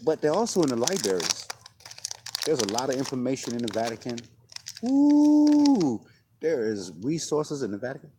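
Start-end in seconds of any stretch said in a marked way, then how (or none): 4.8-6.0 high loud excited ooh. Real, not acted. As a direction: burst out ooh. Sudden excited exclamation.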